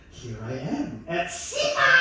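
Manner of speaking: slow lead-in exploding on SIFA; triumphant shout. Real, not acted. Comedian announces arriving at the festival at last, triumphant shout. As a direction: build to a high, loud, held cry.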